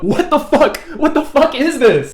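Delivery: high, loud disbelief; shocked. Real, not acted. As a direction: shout it in disbelief. Shocked side note.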